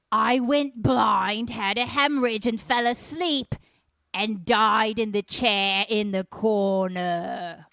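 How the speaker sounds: very loud, drawn-out demon roar; hammed-up possessed-child voice; demonic possession voice. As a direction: bellow slowly like a territorial ghost. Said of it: "it has this voice".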